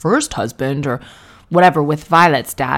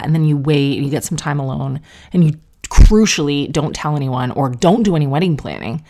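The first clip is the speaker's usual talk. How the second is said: ordinary talk, nothing marked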